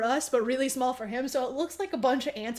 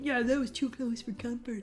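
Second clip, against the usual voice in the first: male character's reply; 2 semitones lower, 6 dB quieter than usual. Retold or imitated. Man answering the girl about the nuke, subdued character voice. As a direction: drop the voice and agree quietly. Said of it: "And he's like".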